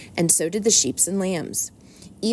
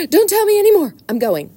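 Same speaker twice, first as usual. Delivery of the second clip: eager rat cutting in; 13 semitones higher, 7 dB louder than usual. Acted.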